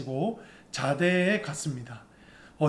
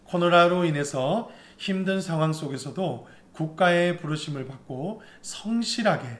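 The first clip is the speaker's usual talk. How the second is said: ordinary talk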